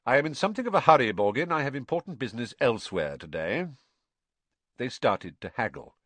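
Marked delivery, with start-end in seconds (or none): 0.0-3.7 quick, curt, impatient hurry; brisk and impatient. Acted. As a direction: rattle it off impatiently. Malfoy hurries the dealer.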